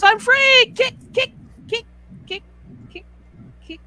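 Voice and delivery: very high, loud shout of freedom, then small clipped kicks; gleeful cartoon frog. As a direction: frog cries free and kicks in place. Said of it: "Frog goes".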